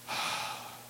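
a long weary sigh falling into bed; exhausted sigh. Retold or imitated. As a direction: sigh out the whole hard day. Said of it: "and you're like".

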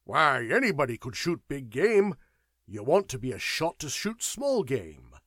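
loud, scornful put-down; aggressive scoff. A narrator's reading. Sir Howard mocking Burke's big game, loudly aggressive. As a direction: bluster your contempt at him. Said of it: "he ejaculated aggressively to Burke".

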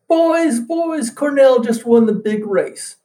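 high, loud, breathless announcing of news; excited interruption. Retold or imitated. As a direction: burst in calling out the big win. Patrician bursts in interrupting debate.

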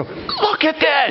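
high, slow, laughing cry of awe; a small voice marveling in heaven; little voice of wonder. Pretend. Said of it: "hear a little voice go".

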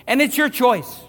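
"your" hit hard, loud and high; urgent emphasis. Real, not acted. Preacher urging the congregation that the choice is theirs, loud. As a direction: drive "your choice" home at full voice.

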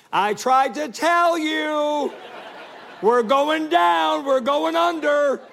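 put-on high, loud, slow squeaky child voice; little kid squeak. Little son in a sinking boat, high squeaky wail. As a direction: squeak it out like a tiny kid in a panic. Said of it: "he's doing just a little squirt".

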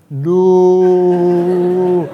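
long drawn-out, loud "no"; mock slow-motion wail, held on one high note; slow-motion cry. Father and daughter watching the doll fall, slow-motion cry. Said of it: "as if everything went into slow motion".

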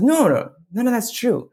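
very high, reassuring storybook voice; wood carver. Acted. Wood carver contradicts Punchinello's self-doubt, high reassuring voice. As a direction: brush the worry away, pitched high. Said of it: "He goes".

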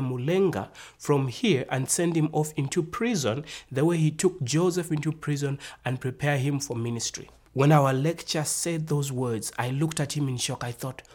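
usual speaking voice

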